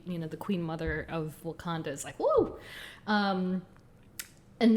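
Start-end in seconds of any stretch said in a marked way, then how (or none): 2.2-2.5 high, loud excited whoop. Real, not acted. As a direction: let out a delighted woo. Gushing over the Queen Mother.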